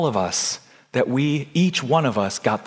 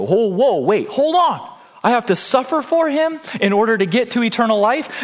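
higher-pitched protest; balking objection; 9 semitones higher, 3 dB louder than usual. Acted. Believer objecting to having to suffer, balking protest. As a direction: pull up short and question it, incredulous. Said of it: "some people don't like this phrase".

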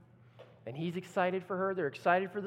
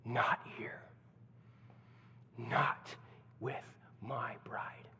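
low, unvoiced whisper; Jesus whispering over his bride; 7 semitones lower, 7 dB quieter, slower than usual. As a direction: hush it like a fierce secret. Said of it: "And Jesus says".

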